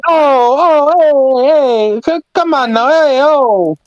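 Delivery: very high hammed-up Italian exclamations; string out mock-Italian heys and ohs; mock Italian protest. Joining the Italian impression, high mock protest. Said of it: "favorite about the Italians".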